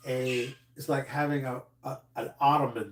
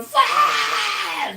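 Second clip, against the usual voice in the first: high loud squawk; bird squawk; 8 dB louder than usual. Retold or imitated. Host's bird losing it, high loud squawk. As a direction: blurt a panicked parrot shriek. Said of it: "And my bird's like going".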